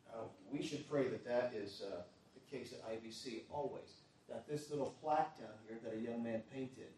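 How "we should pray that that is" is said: normal talking voice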